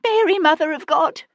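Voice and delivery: high, loud cry of terror; frightened maid crying out in the carriage; terrified cry. A narrator's reading. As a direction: shriek it out in panic. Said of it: "cried the maid".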